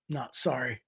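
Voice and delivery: soft, low retold refusal; understated, dropped-voice apology; low brush-off. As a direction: turn him down quietly. Quoting his refusal.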